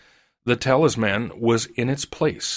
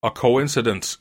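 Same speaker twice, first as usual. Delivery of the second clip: ordinary delivery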